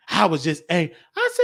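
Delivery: normal talking voice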